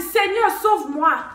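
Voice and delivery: loud, high desperate plea to God; desperate pleading. Retold or imitated. Young woman begging God to save her, loud and desperate. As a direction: beg God to save you, frantic. Praying for her life, terrified.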